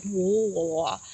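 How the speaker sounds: low garbled noise, dropping away; garbled speech noise. Retold or imitated. Man tries to talk but words fail, garbled. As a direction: imitate speech collapsing into mush. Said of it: "but the words were all".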